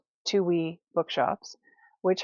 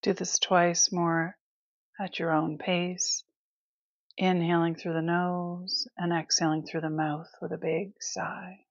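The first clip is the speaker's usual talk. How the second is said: guided meditation. Acted. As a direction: ease them through it with long, restful pauses. Leads breath awareness.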